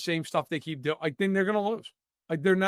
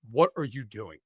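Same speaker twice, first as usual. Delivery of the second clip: exasperated coach; 8 semitones lower than usual. Retold or imitated. Coach asks again, now exasperated.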